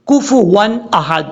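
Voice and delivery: slow, chanted recitation of the verse; slow tajweed chant; Quran recitation. Shown as a demonstration. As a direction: stretch یکن لہو and hold each letter. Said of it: "اب کئی لوگ ایسا بھی".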